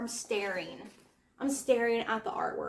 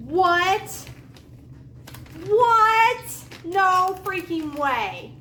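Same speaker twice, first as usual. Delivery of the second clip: loud, high cries of disbelief; real shocked disbelief; 7 semitones higher, 9 dB louder, much slower than usual. Real, not acted. Opening a surprise book gift from a friend, shocked disbelief. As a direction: burst out high and loud, stunned.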